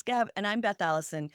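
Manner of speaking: ordinary talk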